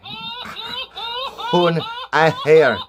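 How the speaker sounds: high, slow, drawn-out Hohen Aher in a put-on Mexican accent; jokey Mexican accent on Hohen Aher; mock Mexican pronunciation. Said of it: "is how the Mexicans say it".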